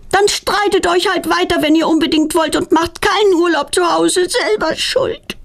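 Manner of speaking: put-on high, petulant girl's complaint; grumbling child. A narrator's reading. Daughter storming upstairs from her parents, high petulant grumbling. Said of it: "verschwindet meckernd nach oben".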